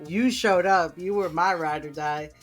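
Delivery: usual speaking voice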